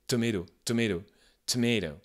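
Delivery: tomato with a breathy T release; aspirated T. Shown as a demonstration. Teacher drilling the American tomato, aspirated. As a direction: repeat tomato with a puff of air on the T.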